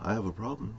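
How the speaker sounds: a hushed, intimidated reply; meek reply. Retold or imitated. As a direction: answer the big guy softly and meekly. Meek reply to a threatening visitor.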